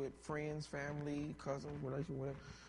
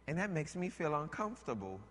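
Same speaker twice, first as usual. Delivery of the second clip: ordinary talk, nothing marked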